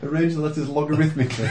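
laughing through the words. Real, not acted. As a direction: repeat it, cracking up. Amused repeat of nonsense.

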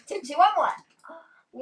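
slow giggly tipsy voice; laughing, drawn out. Retold or imitated. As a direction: laugh through a wobbly slurred line. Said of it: "they're like".

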